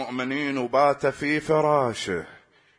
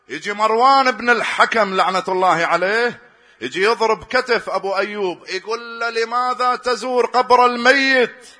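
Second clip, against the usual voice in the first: rising preaching voice; 7 semitones higher, 5 dB louder than usual. Acted. Preaching narration rising to Marwan's taunt.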